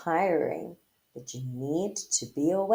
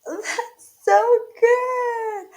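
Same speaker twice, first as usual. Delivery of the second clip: radio static sound; 13 semitones higher, 6 dB louder, much slower than usual. Shown as a demonstration.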